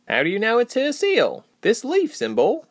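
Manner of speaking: high character voice, querying; questioning Reshma. Acted. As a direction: ask it high, as the doubtful friend.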